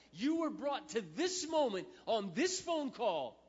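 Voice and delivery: preaching at full force. Acted. Pastor urging Sally that God loves her, full-force preaching. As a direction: drive every line home like a revival pulpit.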